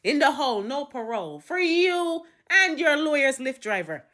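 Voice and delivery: high, emphatic mock judge; indignant courtroom pronouncement; sentencing judge. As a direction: hand down harsh terms with theatrical force.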